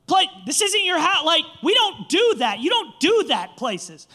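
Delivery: high, loud host telling off a guest; indignant retold telling-off; imitated scolding. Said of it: "she's, like, scolding me".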